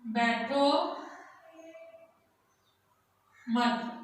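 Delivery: बैठो, long pause, then मत; dictation pace. Shown as a demonstration. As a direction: leave time for pupils to write each word. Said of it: "आगे हम लिखेंगे बैठो मत".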